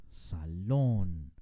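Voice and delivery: "Salón" with a drawn-out, stressed LÓN; stress on last syllable. Shown as a demonstration. Teacher repeating "Salón" with much emphasis at the end, on LÓN. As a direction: hold the final syllable of "Salón": sa-LOOON. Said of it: "con mucho énfasis al final".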